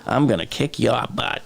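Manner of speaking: imitated thick South Boston accent, r's dropped. Quoting Good Will Hunting to viewers, thick South Boston accent. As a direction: do a movie tough talker from South Boston. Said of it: "South Boston thick accent".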